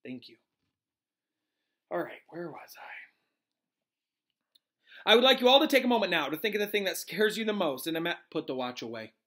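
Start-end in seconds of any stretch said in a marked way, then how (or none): 5.1-8.2 quick, raised, louder professor; Professor Lupin teaching. Acted. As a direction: pick up the lesson briskly.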